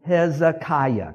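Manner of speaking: Hezekiah drawn out slowly, syllable by syllable. Shown as a demonstration. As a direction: sound out Hezekiah slowly for a crowd to repeat. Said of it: "Say this word with me".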